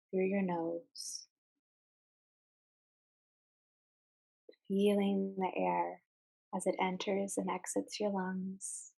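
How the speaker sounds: calm meditation guide with long, unhurried pauses; guided meditation. Real, not acted. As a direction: ease listeners into mindful breathing, phrase by phrase. Leads a guided mindfulness exercise.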